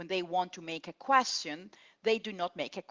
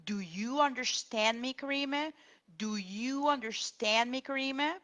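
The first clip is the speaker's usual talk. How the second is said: "Karime?" rising higher; higher rising "Do you understand me, Karime?"; rising question model; 7 semitones higher than usual. Shown as a demonstration. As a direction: ask it as a clear, correct question. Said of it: "put the auxiliary do".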